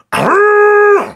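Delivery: squeaky high cackle; loud helium laugh. Acted. (rire aigu à l'hélium)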